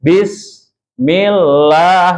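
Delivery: very slow, stretched Bismillah; slow Quranic recitation demo; drawn-out demo. Shown as a demonstration. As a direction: draw out Bismillah with no break after bis. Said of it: "Kalau di bisnya berhenti".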